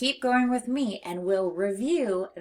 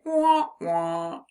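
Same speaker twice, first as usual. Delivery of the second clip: sung "Wah, wah" like a sad trombone; comic wah-wah horn; mock sad trombone; 4 dB louder than usual. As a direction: play the failing trumpet sound.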